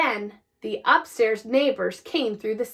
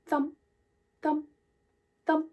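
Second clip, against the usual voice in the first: soft thumps; 3 semitones higher, 8 dB quieter than usual. A narrator's reading. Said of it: "except for a soft".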